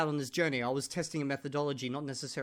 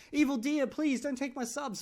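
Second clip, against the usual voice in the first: mock pleading, pitched higher; pleading Martin; 9 semitones higher than usual. Martin begging the vlogger to spare his subscribers, pleading. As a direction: beg like a rival begging for subs. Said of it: "he's gonna be like".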